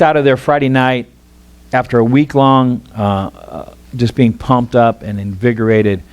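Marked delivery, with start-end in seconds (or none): none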